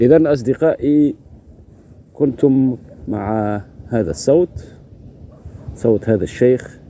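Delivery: slow, measured host outro in formal Arabic; podcast sign-off. Acted. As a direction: close the episode like a radio host, unhurried.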